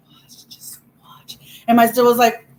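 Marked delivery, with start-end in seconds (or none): 0.1-1.4 slow, very soft whisper; whispered threat. Retold or imitated. Angry kid to her sister, whispered threat. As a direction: whisper it like a secret warning.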